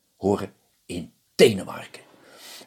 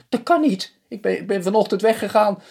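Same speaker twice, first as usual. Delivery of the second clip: very high, loud, stammering protest; protesting husband; 13 semitones higher, 4 dB louder than usual. Acted. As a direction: squeak out the disbelief.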